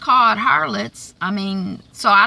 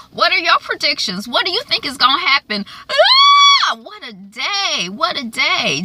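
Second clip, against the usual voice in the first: fast, louder call-out ending in a high shout; rally the viewers; excited outro; 8 semitones higher, 4 dB louder than usual. Real, not acted. Vlogger asking viewers for predictions, excited shout.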